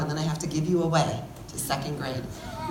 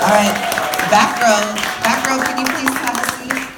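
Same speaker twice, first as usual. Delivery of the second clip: teacher calling over the crowd, louder and higher; 6 semitones higher, 8 dB louder than usual. Real, not acted. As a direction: call the back row to sit down over the noise. Teacher calling class to sit.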